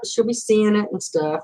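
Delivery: ordinary talk, nothing marked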